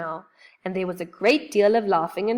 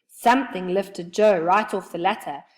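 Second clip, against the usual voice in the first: usual speaking voice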